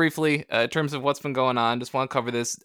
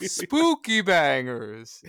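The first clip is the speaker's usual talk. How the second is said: very slow, drawn-out, higher put-on voice; hammed-up catchphrase; 4 semitones higher, much slower than usual. Podcast guest jokes about a Halloween-themed breakfast dish, hammed-up. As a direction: stretch out both words in mock-spooky style. Hams up the quoted phrase.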